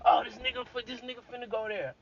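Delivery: high, fast mocking of a doubter; mock listener. Mocking a shocked audience member, high and fast. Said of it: "Don't be like".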